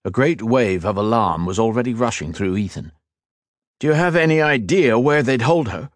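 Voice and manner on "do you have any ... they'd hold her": urgent. A narrator's reading. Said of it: "he asked urgently".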